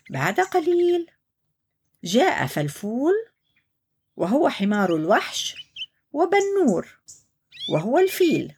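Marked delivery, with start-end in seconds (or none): none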